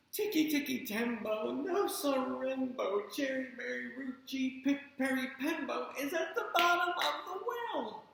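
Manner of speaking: breathless, strained high voice; out of breath. Acted. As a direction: push through with no breath left. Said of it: "very last bit of breath".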